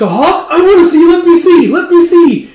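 put-on very high, loud childlike clamour; excited child. Child begging to see the wounded hawk, excited child. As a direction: squeal it like a kid begging for a look.

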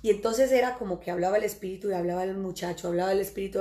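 ordinary talk, nothing marked

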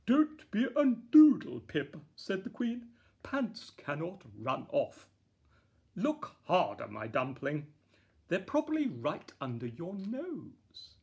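loud fondly scolding mother; fond queen. A narrator's reading. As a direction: chide kindly like a queen.

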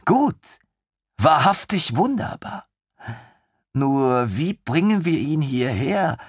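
put-on high, excited young officer; lieutenant thrilled by the idea; eager lieutenant. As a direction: bubble over with enthusiasm. Lieutenant delighted.

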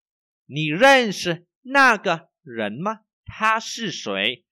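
slow, loud, carefully spaced Chinese for learners; slow model reading. Shown as a demonstration. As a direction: read each syllable slowly for the class.

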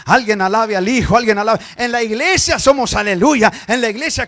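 loud, driving sermon shout; full-force preaching. Acted. Preacher scolds the church about fake conversion, loud and fervent.

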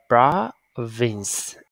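"province" with drawn-out PRAA, then vins; long A vowel. Shown as a demonstration. Teacher models the word for the class, long AH in "province". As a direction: stretch the first vowel of "province" as AH. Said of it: "A hai chấm ha".

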